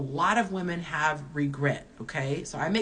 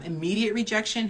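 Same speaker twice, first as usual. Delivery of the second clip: ordinary delivery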